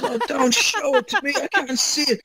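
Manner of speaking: high, tearful mock pleading; imitated crying. Tough kid begging not to see the wound, imitated crying. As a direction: wail it like a kid in tears. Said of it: "he started to cry then".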